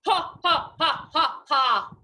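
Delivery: put-on loud villain's cackle for the kids; evil laugh. Shown as a demonstration. Teacher showing the class an evil laugh, loud. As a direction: show them a wicked laugh. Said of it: "Can everybody give me an evil laugh".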